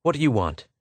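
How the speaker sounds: curt, suspicious challenge; brusque protective question; sharp demand. A narrator's reading. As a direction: ask it sharply. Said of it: "He said sharply".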